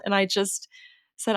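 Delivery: ordinary talk, nothing marked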